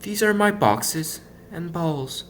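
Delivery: high, slow small voice; Punchinello, said quietly. A narrator's reading. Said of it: "he said quietly".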